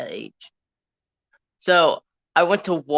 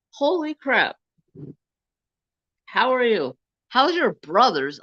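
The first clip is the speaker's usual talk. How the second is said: high, excited holy crap; retold amazed greeting; 8 semitones higher, 4 dB louder than usual. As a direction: greet an old friend with delight. Recognizing old classmate.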